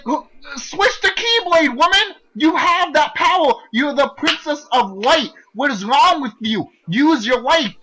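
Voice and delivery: high, loud frustrated shouting at the character; exasperated yelling. Retold or imitated. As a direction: yell at her to fight back. Yelling at Kairi on screen.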